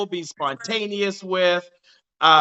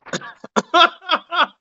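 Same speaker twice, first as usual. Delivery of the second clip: high laugh; 10 semitones higher than usual. Real, not acted. Host laughs at guest.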